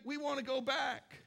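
a hint of whiny pleading; whiny people. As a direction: beg to go back, a bit whiny. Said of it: "they're saying".

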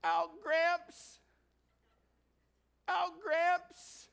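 put-on high loud toddler cry. Baby in the playpen calling to grandpa. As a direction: wail like a cranky toddler reaching up. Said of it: "Reaches up those little chubby hands".

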